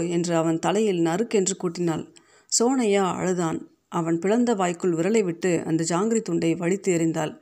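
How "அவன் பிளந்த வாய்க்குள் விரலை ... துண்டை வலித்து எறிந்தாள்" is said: ordinary delivery, nothing marked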